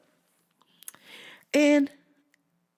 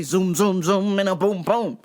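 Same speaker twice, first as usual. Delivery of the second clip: car noise; 4 dB louder than usual. Retold or imitated. Car sound effects in story.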